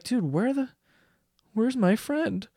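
lightly voiced abandoned pal; confused dog. Dog back with the ball seeking his friend, confused. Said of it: "the dog's so confused".

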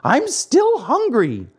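high, slow, drawn-out whine; wailing. A narrator's reading. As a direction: wail it like a hungry puppet child. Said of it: "he yawned deeply and wailed".

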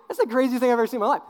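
fast, high, loud amazement; hammed-up incredulous outburst; mock astonished driver. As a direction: blurt it like a stunned motorist. Mimics shocked driver.

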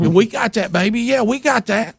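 put-on high, raspy old waitress; sweet-talking smoker's voice; hoarse waitress voice. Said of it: "that has a hoarse voice".

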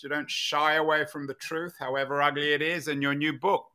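ordinary delivery

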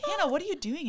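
high, amused disbelief; bewildered, high question. Retold or imitated. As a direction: ask your sister what on earth she's doing. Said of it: "I was like".

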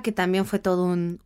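normal talking voice

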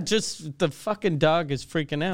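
ordinary talk, nothing marked

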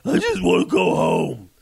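high, slow, loud whine; drunk whining plea. Retold or imitated. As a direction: wail it like a drunk who wants home. Drunk man pleading in story.

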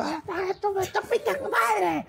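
very high, strained mock yell; imitated waiter yelling. Traveler imitating rude waiters abroad, shrieky mock yelling. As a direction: hurl a hammed-up, high-pitched insult. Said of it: "Te empiezan a gritar".

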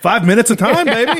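high, laughing boast. Real, not acted. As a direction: gloat through a laugh.

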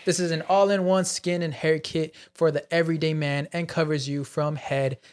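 measured, announcer-like sponsor read. Acted. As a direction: deliver it like a podcast sponsor shout-out. Reads sponsor ad.

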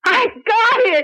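high, slow, tearful shout of joy; joyful cry. Acted. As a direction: cry it out in triumph. Shouting news of the lead.

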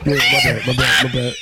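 loud apology. Real, not acted. Apologizing amid laughter.

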